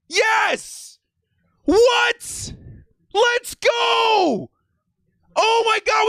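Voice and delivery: high, triumphant screams; ecstatic shouting. Real, not acted. Fan can't believe we got Ladd, ecstatic shouting. Celebrating the draft pick.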